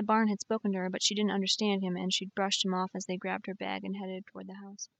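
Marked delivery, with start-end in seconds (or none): none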